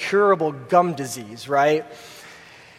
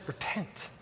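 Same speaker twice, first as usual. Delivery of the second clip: a single whispered word, almost breathless; whispered command; 17 dB quieter than usual. Acted. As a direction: whisper it like a sacred secret. God's one-word call.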